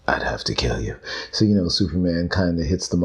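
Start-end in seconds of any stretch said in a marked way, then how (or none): 0.1-1.0 hushed conspiratorial whisper. Pretend. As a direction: breathe it like a secret. Joking secret threat.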